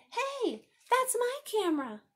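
put-on high, loud boy's objection; acted outraged child; indignant protest. A narrator's reading. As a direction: call out to claim what is yours. Boy objects, starting a quarrel.

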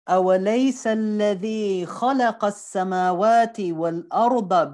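fast, loud chanted Arabic recitation; Quran recitation. Acted. Teacher recites the verse to the class in a loud, fast chant. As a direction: recite the verse with full cantor force.

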